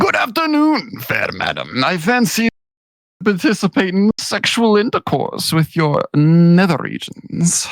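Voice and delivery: put-on high, plummy British aristocrat accent, very proper; British royalty voice. Friend reads a lewd line in a posh British royalty accent. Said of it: "in a fancy British royalty voice".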